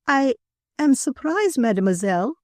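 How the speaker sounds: high, halting French madame; flustered stammer. A narrator's reading. Confused madame to the amused woman editor, stammering. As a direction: stammer in surprise, pitch up. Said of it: "she stammered".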